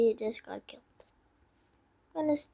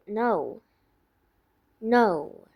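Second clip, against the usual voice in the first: normal talking voice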